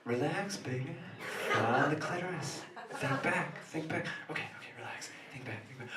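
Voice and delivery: anxious muttering. Acted. Panicked self-coaching under breath.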